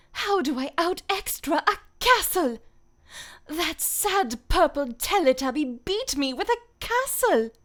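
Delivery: high, loud chavvy English voice; chavvy accent. Acted. Voice actor retaking a requested line for chat, chavvy accent. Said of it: "let me do that again".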